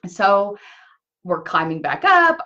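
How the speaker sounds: usual speaking voice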